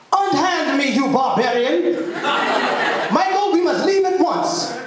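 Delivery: outraged mock Queen's English, nose in the air. Boy scolding the mechanic Jabbo in a hammed-up Queen's English. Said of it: "breaks into the Queen's English".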